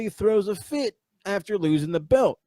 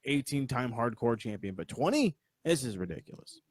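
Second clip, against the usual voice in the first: usual speaking voice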